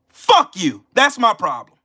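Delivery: normal talking voice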